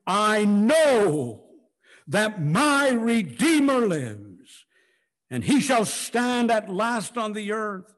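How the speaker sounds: triumphant full-voiced declaration, high and loud; yelling out. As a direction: cry it out at the top of your lungs. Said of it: "Job yelling it out at the top of his lungs".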